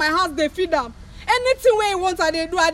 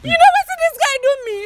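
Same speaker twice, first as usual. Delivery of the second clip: fast, tearful, raised voice; crying accusation; 8 semitones higher, 3 dB louder, faster than usual. Acted. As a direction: sob out the accusation at him. Angry woman crying at ex.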